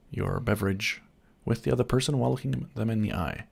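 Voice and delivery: usual speaking voice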